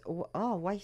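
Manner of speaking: ordinary talk, nothing marked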